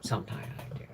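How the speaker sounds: soft unvoiced confession; sneaky little whispered admission; whispered aside. Real, not acted. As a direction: whisper it like a guilty secret.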